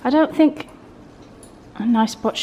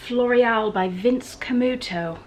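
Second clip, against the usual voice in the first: ordinary talk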